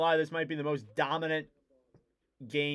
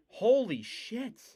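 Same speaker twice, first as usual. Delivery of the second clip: slow awed exclaim; 7 semitones higher, slower than usual. Real, not acted. Amazed exclamation.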